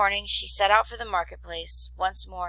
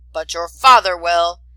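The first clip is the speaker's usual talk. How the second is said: much louder, contemptuous threat; loud sneer; 13 dB louder than usual. Acted. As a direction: throw the threat at the boy. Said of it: "he sneered".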